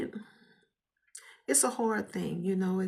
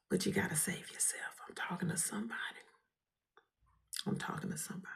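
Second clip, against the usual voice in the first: whispered advice; 9 dB quieter, faster than usual. Real, not acted.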